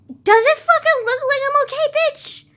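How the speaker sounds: high, loud mock-furious yell; imagined yell. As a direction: scream the comeback you never said. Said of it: "No! But I didn't say".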